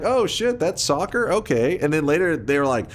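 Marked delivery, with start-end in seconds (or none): 0.0-1.8 mock surprise. Imitating surprised Americans.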